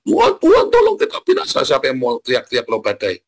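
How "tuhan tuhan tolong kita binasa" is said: hammed-up scream for help, much higher and louder; mock panicked yell. Preacher mocking believers who scream in storms, with a high, loud, hammed-up wail. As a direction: shriek it like a frightened disciple.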